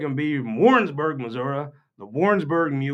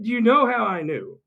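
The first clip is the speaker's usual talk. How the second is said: high, teasing setup; mock-pointed question; 9 semitones higher than usual. Retort to Greg, high and teasing. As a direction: lean in to deliver the punchline. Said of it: "And I was like".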